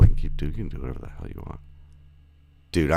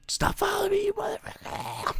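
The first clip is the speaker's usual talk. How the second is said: mock whisper. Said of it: "when you were like".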